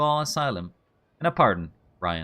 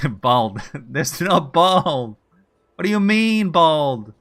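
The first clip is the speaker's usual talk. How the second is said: laughing; 6 dB louder, much slower than usual. Real, not acted. Laughing over misheard word.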